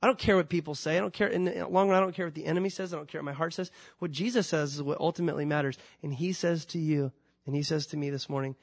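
ordinary talk, nothing marked

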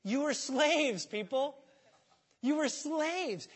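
high, slow, punched words; emphatic rebuke. Real, not acted. Preacher confronting congregation, high slow insistence. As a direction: drive each word home.

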